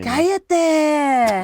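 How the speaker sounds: loud, high, drawn-out Spanish shush; mock scolding. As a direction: playfully yell it, stretching the word.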